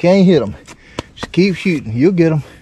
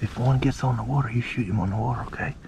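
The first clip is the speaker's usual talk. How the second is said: low, very soft coaching under the breath; hushed instructions; 8 semitones lower, 15 dB quieter, faster than usual. Real, not acted. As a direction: keep it low so nothing hears you. Coaching child as ducks approach.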